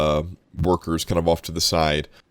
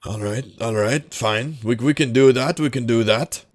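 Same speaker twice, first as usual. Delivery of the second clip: grudging gambler; 5 semitones higher than usual. Acted. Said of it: "they kinda look at you and go".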